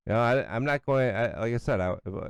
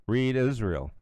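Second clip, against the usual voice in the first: normal talking voice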